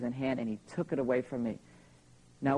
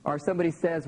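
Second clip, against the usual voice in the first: ordinary talk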